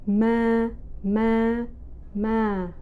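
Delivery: "Ma" held long and level each time; flat mid-level tone. Shown as a demonstration. Teacher modelling the first tone, flat and emotionless. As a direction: hold each "Ma" steady, with no emotion. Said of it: "the no emotion tone".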